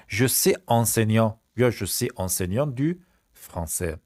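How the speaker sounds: Je suis enseignant said slowly, no article; slow correct model. Shown as a demonstration. Teacher to class, slow correction model. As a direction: model the right form slowly. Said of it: "slow correct model after wrong form".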